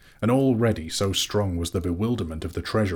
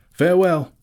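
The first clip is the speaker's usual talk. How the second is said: high, loud parting call; cried farewell; 12 semitones higher, 9 dB louder than usual. A narrator's reading. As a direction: call goodbye across a distance. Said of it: "he cried to them".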